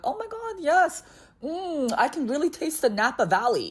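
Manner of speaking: high, gushing fake delight; mock wine snob. Pretending to love any red wine, high mock rapture. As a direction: pretend to taste the terroir.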